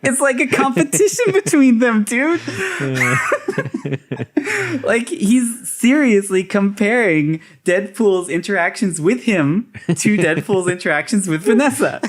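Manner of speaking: high, laughing disbelief; cracking up while explaining; real laughter, pitched high. Real, not acted. Laughing incredulous commentary.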